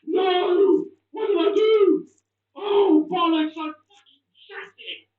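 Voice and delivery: loud, high, furious shouting; furious yell. Real, not acted. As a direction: scream at the failed shot. Last chance missed.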